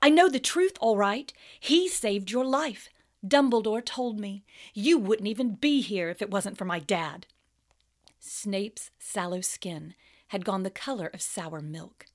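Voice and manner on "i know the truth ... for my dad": furious schoolboy; angry Harry. Acted.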